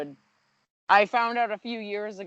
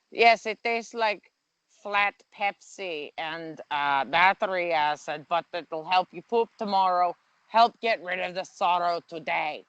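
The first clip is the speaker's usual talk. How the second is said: halting mock-German endorsement; bad German accent. Joker voices Germans praising a digestive, bad German accent. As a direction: put on a clumsy German accent. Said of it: "I literally can't do a German accent".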